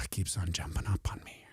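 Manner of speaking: whispered complaint; whispered grumble. Real, not acted. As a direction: grumble it under your breath. Unvoiced muttered complaint.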